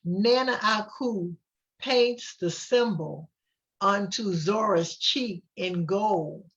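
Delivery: ordinary delivery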